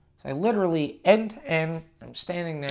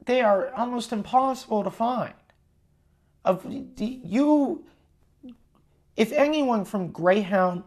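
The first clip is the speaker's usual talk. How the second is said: higher-pitched, indignant venting; exasperated rant; 6 semitones higher than usual. Real, not acted. Angry customer scolding Greyhound over its website, exasperated. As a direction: tell the company off, raised and indignant.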